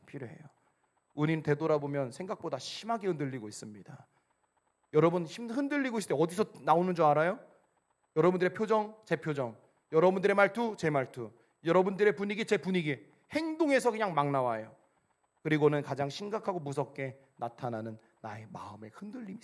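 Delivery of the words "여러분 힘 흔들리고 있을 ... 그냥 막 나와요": raised, louder sermon delivery; forceful preaching. Real, not acted. Preacher listing where a shaken heart shows, forceful preaching. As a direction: drive each item home to the congregation.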